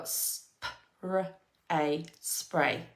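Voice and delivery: "spray" split into s, p, r, a then blended; sounding out. Shown as a demonstration. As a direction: segment "spray" slowly. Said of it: "we've got".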